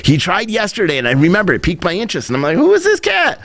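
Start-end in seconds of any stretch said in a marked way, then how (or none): 2.6-3.4 high-pitched intrigued question; mock-astonished, pitched way up; intrigued reaction. Host recalling his reaction to the reporter, intrigued. Retelling own piqued reaction.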